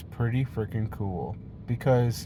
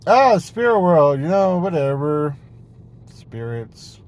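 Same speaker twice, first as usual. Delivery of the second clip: mock dismissal; 4 semitones higher, 9 dB louder than usual. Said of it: "It's like".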